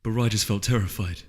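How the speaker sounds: plain reading voice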